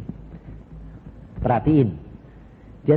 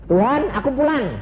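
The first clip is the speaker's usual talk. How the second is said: pleading to God; 11 semitones higher than usual. Retold or imitated. Pleading to Jesus at throne.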